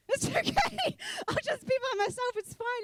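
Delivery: high laughing brush-off. Retold or imitated. Refusing the girl's Christmas invite with a high laugh. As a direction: laugh through the polite refusal.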